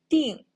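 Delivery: 定 said dìng, sharply falling; teacher modelling 定 loudly. Shown as a demonstration. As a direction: hit 定 with a strong fall. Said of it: "is a first time character".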